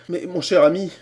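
usual speaking voice

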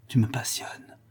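a hushed, breathy whisper. Acted. Cécilie murmurs her fascination to the notary. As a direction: murmur it like a confession.

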